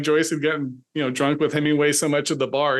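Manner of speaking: normal talking voice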